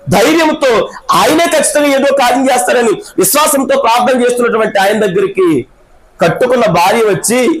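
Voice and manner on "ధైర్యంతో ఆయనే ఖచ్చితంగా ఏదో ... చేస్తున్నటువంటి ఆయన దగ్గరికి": high, ringing preacher's call over music; full-force preaching. Acted. Preacher rousing the congregation, full-force high preaching.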